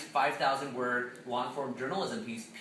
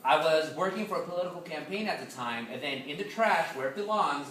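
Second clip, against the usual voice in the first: ordinary delivery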